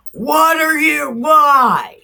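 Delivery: high, loud, outraged yell; furious shout. Retold or imitated. Grieving mother bursting in on the prophet, shouting. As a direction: storm in screaming at him.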